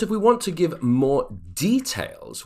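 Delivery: usual speaking voice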